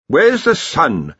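put-on slow, querulous old academic; a lightly voiced fussy wizard. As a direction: ask it like a fretful don. The senior wrangler asks.

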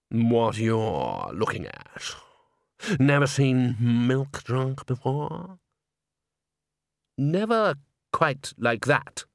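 slow, odd, drawn-out voice; creepy slow voice. A narrator's reading. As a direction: lurk over each word. Gribaud voiced, slow and strange.

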